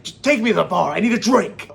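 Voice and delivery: fed up and demanding it now; exasperated demand. Acted. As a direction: cry out in mock desperation. Acted exasperated demand to parents.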